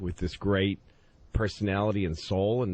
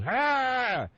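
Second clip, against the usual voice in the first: mock shout; 13 semitones higher than usual. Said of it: "he goes".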